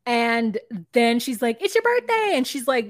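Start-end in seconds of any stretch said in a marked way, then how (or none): 1.6-2.3 high-pitched, over-cheery birthday shout; mock birthday yell. Retelling a birthday greeting to a grown woman, mock yelling. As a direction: imitate someone yelling birthday wishes as if to a child. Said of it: "yelling about happy birthday".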